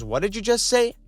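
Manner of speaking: mock indignant referee. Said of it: "Ref goes".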